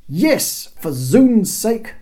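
high, loud, drawn-out oath; exasperated curse. A narrator's reading. Bob answering the obtuse guards, cursing. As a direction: curse up at the fools. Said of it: "cursed the Great One".

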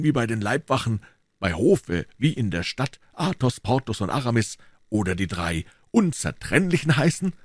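explaining the inseparable three; a hint of a musketeer's pride; Athos. Athos voiced in read story.

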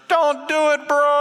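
high squeaky donkey voice pleading with Balaam; talking donkey. Acted. Donkey warns Balaam on the road, high pleading voice. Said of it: "this donkey turns around and says".

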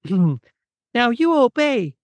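high, slow, drawn-out cartoon mouse voice; slow squeaky-high command in a silly character voice; Muffy voice. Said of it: "I'm gonna do my best Muffy voice".

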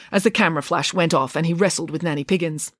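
ordinary delivery

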